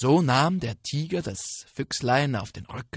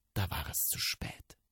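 suspense whisper; 5 semitones lower, 7 dB quieter than usual. A narrator's reading. Spannender Erzählmoment.

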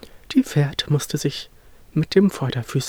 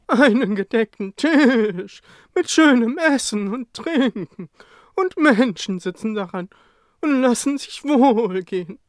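high, louder horse voice describing a feast; higher horse voice amazed at the laden table; delighted horse; 9 semitones higher, 5 dB louder than usual. Acted. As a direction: marvel at the food like a hungry horse. Horse peers through window.

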